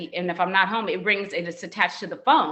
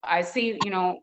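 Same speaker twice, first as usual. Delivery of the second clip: usual speaking voice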